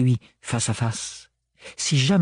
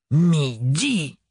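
interloqué; 3 semitones higher than usual. A narrator's reading. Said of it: "répéta Thorne interloqué".

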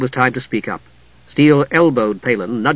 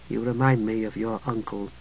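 much softer, dropped low; 3 semitones lower, 11 dB quieter than usual. A narrator's reading. Priestess quietly telling Palin of his uncle, hushed. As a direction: let it sink to a confiding hush. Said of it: "The last she said in a low voice".

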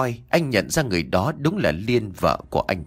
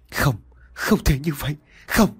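restrained anguished cry, higher-pitched; crying out in denial; 4 semitones higher than usual. A narrator's reading. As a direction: cry out in disbelief, without full volume. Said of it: "Anh gào lên".

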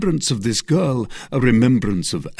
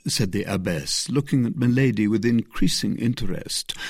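ordinary delivery, nothing marked

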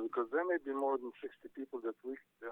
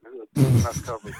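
loud punchline; 9 dB louder, slower than usual. Real, not acted. Dad's punchline boast.

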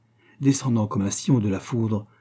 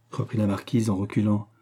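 ordinary delivery

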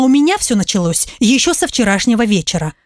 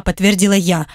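ordinary delivery, nothing marked

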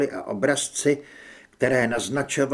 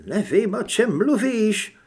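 higher-pitched flustered denial; nervous stammer; 6 semitones higher, 4 dB louder than usual. A narrator's reading. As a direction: deny it in a scared, raised voice. (vyděšené zakoktání vyšším hlasem)

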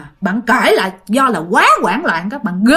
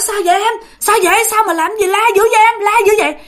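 high, urgent, worried questions; alarmed questioning; 9 semitones higher, faster than usual. Acted. Neighbours rush out to the screaming sleeper, high alarmed questioning. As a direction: fire off startled questions, pitched right up.

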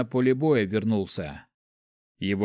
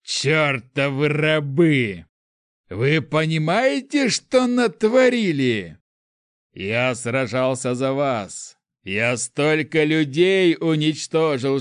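slow, loud, raised-pitch ranting; furious tirade; 3 semitones higher, 5 dB louder, slower than usual. Acted. Prince berating the people he fought for, furious tirade. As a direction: hammer out each word in rage.

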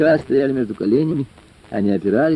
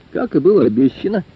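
plain reading voice

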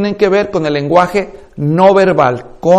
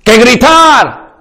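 mock shout; 4 semitones higher, 4 dB louder, much slower than usual. Shown as a demonstration. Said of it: "lo mejor es una pausa prolongada".

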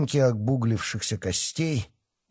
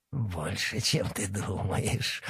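nasty giggle; 3 semitones lower, 7 dB quieter, slower than usual. A narrator's reading. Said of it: "противно захихикал вурдалак".

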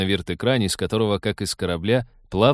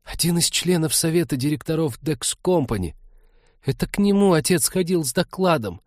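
put-on higher, nervous young voice; halting whisper; 7 semitones higher than usual. A narrator's reading. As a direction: rush it out, shaken and unsure. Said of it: "сбивчиво прошептала Кира".

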